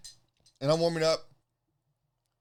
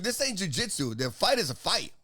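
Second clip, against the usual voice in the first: normal talking voice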